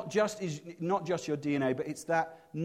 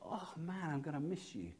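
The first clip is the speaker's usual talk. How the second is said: hushed sad inner voice; 14 dB quieter than usual. Retold or imitated. Father misses son at empty space.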